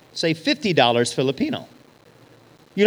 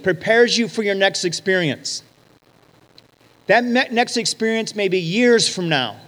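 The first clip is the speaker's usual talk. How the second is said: usual speaking voice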